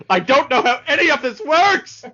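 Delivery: high, loud frantic cartoon shriek; Pith snapping. Pith snapping at being helpless, shrill frantic yell. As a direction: lose it completely, voice cracking high. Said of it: "really fun to hear Jeff Bennett as Pith, as he completely snaps".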